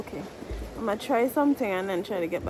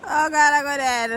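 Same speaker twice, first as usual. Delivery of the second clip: exasperated laugh; 5 semitones higher, 7 dB louder than usual. Real, not acted. Dunked again.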